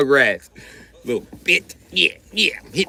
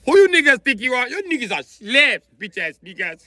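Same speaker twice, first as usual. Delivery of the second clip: angry mock rant; 10 semitones higher than usual. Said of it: "Africa got talking shit".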